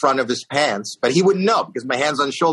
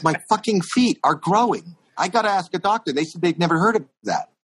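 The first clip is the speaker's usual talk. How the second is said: high-pitched, distressed insistence; worked-up alarm; 5 semitones higher than usual. Retold or imitated. Pranked singer insists to comic about his feet, worked-up alarm. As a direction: imitate a man baffled by his own body, pitched up.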